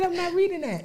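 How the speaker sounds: high, lightly mimicked friend; mimicking a friend. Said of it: "That lady said".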